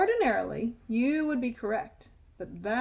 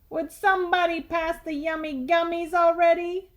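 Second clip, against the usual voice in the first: loud tooth; 6 semitones higher, 5 dB louder than usual. Acted. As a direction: blurt it across the classroom. Tooth in the movies.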